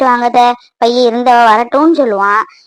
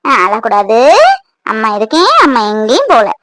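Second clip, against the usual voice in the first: put-on soothing baby talk; 5 semitones lower than usual. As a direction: hush the frightened baby gently. Mother soothing frightened baby. (குழந்தையை தேற்றும் மென்மையான குரல்)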